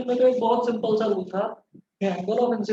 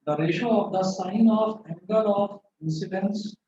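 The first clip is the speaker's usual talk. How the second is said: slow dictation, word groups split by long pauses; classroom dictation; much slower than usual. Real, not acted. Teacher dictating a physics rule to students, slow dictation. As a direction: dictate slowly so students can write.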